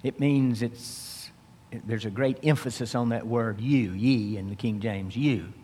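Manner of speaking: ordinary talk, nothing marked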